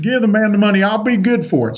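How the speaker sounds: imitated Texan vouching for a friend. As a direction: repeat the Texan order firmly. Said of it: "he talks like a Texan".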